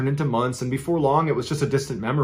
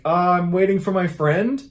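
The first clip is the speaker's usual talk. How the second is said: put-on higher, tentative child's answer; mildly nervous, pitched-up excuse; uneasy reply; 5 semitones higher than usual. Child nervously answers stranger in story.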